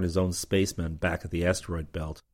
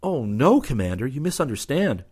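feigned shock; 6 semitones higher, 4 dB louder than usual. Acted. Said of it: "in a shocked gesture".

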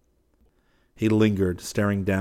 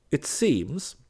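high, swooping mock indignation; outraged virtue; 7 semitones higher than usual. A narrator's reading. West protesting to Fallowby, with outraged virtue. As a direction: play the wronged innocent, pitched high. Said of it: "assumed an air of outraged virtue".

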